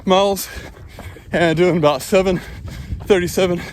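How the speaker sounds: breathless, talking mid-run; puffing between phrases on a run; while running. Real, not acted.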